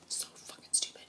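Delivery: hushed so fucking stupid; disgusted whisper; whispered self-scolding. Real, not acted. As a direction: whisper it at yourself. Self-reproach about luggage.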